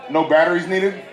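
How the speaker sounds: ordinary delivery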